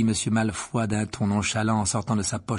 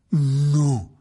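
growled no; 4 semitones higher, 9 dB louder than usual. A narrator's reading. Hagrid growls his refusal. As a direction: rumble a refusal. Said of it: "gronda Hagrid".

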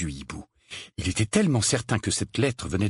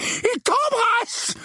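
very high, drawn-out outcry; appalled exclamation; 3 dB louder, much slower than usual. A narrator's reading. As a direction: cry it out aghast, stretching the words. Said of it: "s'exclama son oncle". (exclamation outrée, suraiguë et traînante)

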